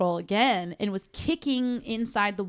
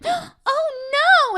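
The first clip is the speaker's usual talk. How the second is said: high, loud, hammed-up panic; mocking a scared boyfriend, much higher and louder; mock fright; 8 dB louder than usual. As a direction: squeal it like a grown man scared of a leashed dog.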